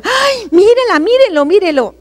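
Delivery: high, swooping mock outcry; scandalised crowd. Everybody pointing at a hypocrite, scandalised outcry.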